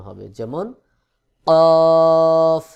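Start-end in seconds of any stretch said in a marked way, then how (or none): 1.5-2.6 কফ from the tongue root against the palate, loud and long. Shown as a demonstration. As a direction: draw out কফ loudly on a raised level tone. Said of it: "জিহ্বার গোড়া তার বরাবর উপরের তালুর".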